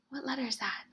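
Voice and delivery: ordinary talk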